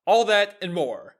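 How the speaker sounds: big, punchy talk-show intro; show intro announcer. Acted. Host teasing tonight's show to listeners, announcer style. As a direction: build to a loud, drawn-out show title.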